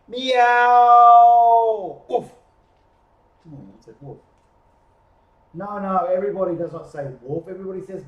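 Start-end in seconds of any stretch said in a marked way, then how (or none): 0.1-2.0 drawn-out, high, loud cat meow; long loud meow. Acted. Calling the chorus of animals. 2.1-2.3 short, sudden dog bark; dog woof. Acted. Dog interrupts the meow. 3.5-4.2 low, very soft little admission; dog owning up. Acted. Dog's sheepish reply in story.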